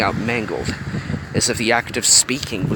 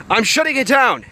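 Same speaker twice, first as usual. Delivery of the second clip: shouting; 11 semitones higher than usual. A narrator's reading. Craig calling from the control room, urgent shout. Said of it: "Craig shouted from the control room".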